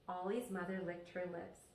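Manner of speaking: ordinary delivery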